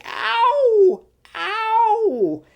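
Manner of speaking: squeaky high hammed-up "ow" with a laugh; mock pained ow. Imitating beaten Ariana's funny pain, squeaky high mock ows. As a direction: play a comic yelp of pain, very high.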